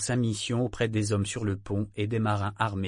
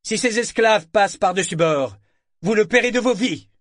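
loud, raised-pitch warning; shouted threat; 9 semitones higher, 8 dB louder than usual. A narrator's reading. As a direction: threaten the enemy crew across the water. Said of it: "il cria au Cicerne". (menace criée au navire adverse)

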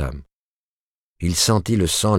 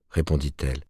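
usual speaking voice

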